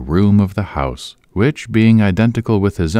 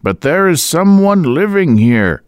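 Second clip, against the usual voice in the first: louder and higher, insisting; shouting; 11 semitones higher, 6 dB louder than usual. A narrator's reading. Boyce shouting at the deaf old landlady. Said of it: "shouted Boyce".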